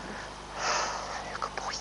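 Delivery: slow, soft whisper; whispered praise. Real, not acted. Owner whispering praise to her good horse, slow tender whisper. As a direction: murmur it close and tender.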